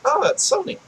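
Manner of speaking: high, soft "oh" of recognition; retold recognition. Recalling spotting Crash Bandicoot as Sony's mascot, retold recognition. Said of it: "I was like".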